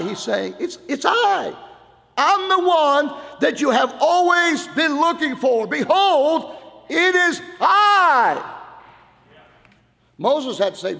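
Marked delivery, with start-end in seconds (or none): 0.6-8.6 high, loud proclaiming voice of God; God calling out. Acted. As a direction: cry it out as God pleading with his people.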